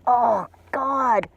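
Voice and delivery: real awestruck outburst; overwhelmed. Real, not acted. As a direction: cry out, overcome by the sight.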